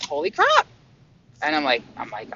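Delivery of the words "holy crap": much higher, sudden shocked cry; jolted by the energy moving through; startled exclamation. Retold or imitated. Feeling the energy during meditation, high and startled.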